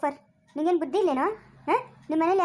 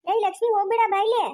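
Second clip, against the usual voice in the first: raised voice calling after her; 4 semitones higher than usual. Acted. Man calls after Lakshmi walking away, raised voice. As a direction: call her back as she walks off.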